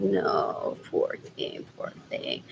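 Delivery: voiceless hushed pity; dad's whisper. Retold or imitated. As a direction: whisper it like tucking a child in. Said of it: "He's like".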